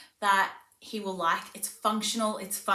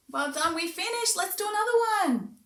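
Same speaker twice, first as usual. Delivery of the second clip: high, fast cheering; praising a toddler, upbeat; bright praise; 10 semitones higher than usual. Shown as a demonstration. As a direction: cheer the child on. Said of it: "praising pretend child".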